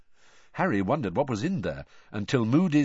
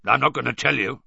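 put-on lightly gruff old teacher's refusal; 4 dB louder than usual. A narrator's reading. Moody refusing to help Harry outright, gruffly. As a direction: refuse bluntly, with a touch of gruffness. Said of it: "said Moody gruffly".

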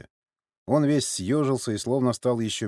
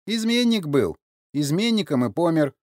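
high, louder scornful verdict; mocking; 8 semitones higher, 5 dB louder than usual. A narrator's reading. Sergei scorns the old man's famous ancestor, mocking. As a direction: pronounce it with derision. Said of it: "насмешливо спросил Сергей".

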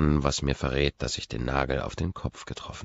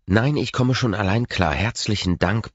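higher, louder sarcastic retort; snapping; 5 semitones higher, 6 dB louder than usual. A narrator's reading. Woman refusing his offer of an ex to talk to, snapping. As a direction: snap back, refusing the offer. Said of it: "blafft sie".

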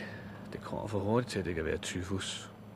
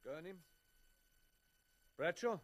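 calling out names; 8 semitones higher, much slower than usual. Acted. Roll call, calling out names.

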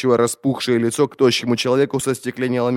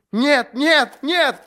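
very high, panicked cries; frantic denial; 4 dB louder, much slower than usual. A narrator's reading. Prisoner denying to the inquisitor he acted alone, frantic.

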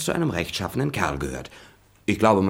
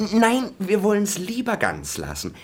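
high, startled quick refusal; flustered high no; alarmed backing off; 13 semitones higher than usual. Acted. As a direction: jump in to stop her. Hastily backs off dissecting.

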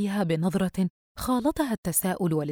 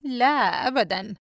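slow, higher mocking denial; smiling sarcasm; 5 semitones higher than usual. A narrator's reading. Brother denying he meant to punish sister, sarcastic. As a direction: draw it out with a smirk. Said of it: "باسمًا بنبرة متهكمة".

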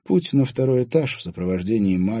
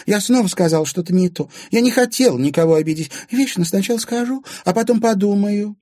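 very high, gushing apology; Anfisa, acting contrite; 13 semitones higher, 6 dB louder than usual. A narrator's reading. Scolded Anfisa excusing her loose tongue, showily contrite. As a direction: excuse yourself in a high, innocent voice.

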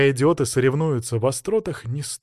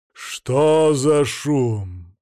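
angry booming; 4 dB louder, much slower than usual. A narrator's reading. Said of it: "зло прогремел Владимир".

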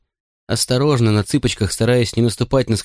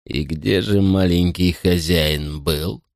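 frighteningly slow; 2 semitones lower, much slower than usual. Acted. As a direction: drag every word out slowly. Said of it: "заговорил пугающе медленно".

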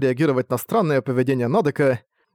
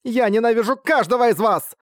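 shouting while sobbing; 9 semitones higher than usual. Acted. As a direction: yell it through sobs at classmates. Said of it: "прокричала, рыдая".

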